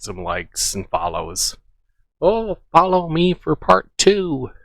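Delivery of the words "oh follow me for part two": higher-pitched mocking imitation; mock influencer plea. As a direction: mimic someone fishing for followers, pitched high. Mocks clip maker begging for followers.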